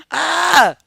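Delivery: short high cry, falling at the end; high exclaimed "ah". Real, not acted. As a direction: let out a high-pitched "ah". Own exclamation after laughing.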